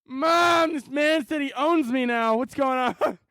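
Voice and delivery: put-on a scared little boy crying out to his mother; panicked kid calling Mom. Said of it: "And Jimmy's like".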